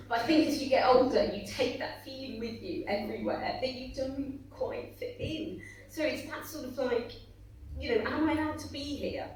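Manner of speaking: holding back tears as the words come; getting emotional. Real, not acted. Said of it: "God, I'm getting emotional now".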